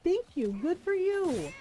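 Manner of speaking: cheering on the dock workers; cheering them on. Retold or imitated. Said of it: "and I was like".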